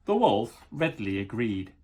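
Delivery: plain reading voice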